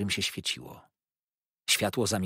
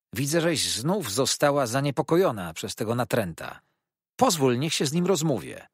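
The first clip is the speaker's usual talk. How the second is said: higher, somewhat forceful appeal; raised voice; 5 semitones higher, 5 dB louder than usual. A narrator's reading. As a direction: speak up firmly on her behalf. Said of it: "podnosząc głos".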